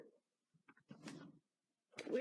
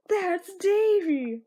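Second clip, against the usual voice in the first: excited shout; 7 semitones higher, 6 dB louder, much slower than usual. Acted. Reading comic, character exclaims.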